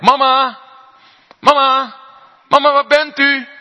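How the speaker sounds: high, loud calls for mama through the house; child calling out. Pretend. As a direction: call out like a lost child searching. Kind roept door leeg huis. (hoog en luid roepend kind)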